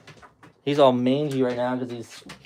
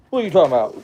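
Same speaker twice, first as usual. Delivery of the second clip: higher, louder playful dog talk; louder teasing the dog; 4 semitones higher, 9 dB louder, much faster than usual. Real, not acted. Owner teasing his grumbling husky, higher and louder. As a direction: tease him again, bigger and higher.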